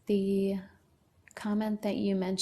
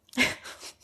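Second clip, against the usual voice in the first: burst of laughter. Real, not acted. Laughs at viewer's teasing.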